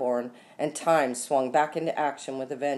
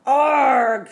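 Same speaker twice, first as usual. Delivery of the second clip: high, loud drawn-out scream; 11 semitones higher, 10 dB louder than usual. A narrator's reading. Rita with a squirrel in her hair, screaming. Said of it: "screamed Rita".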